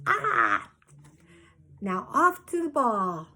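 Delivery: a high, loud delighted cry, then send her off. A narrator's reading. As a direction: cheer her off to the ball. Quilter sends ghost off.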